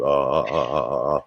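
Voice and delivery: low, resonant "ah" repeated; deep chest-voice exercise; deep notes. Shown as a demonstration. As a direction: sound it from the bottom of the sternum. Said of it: "that one will get your voice deeper".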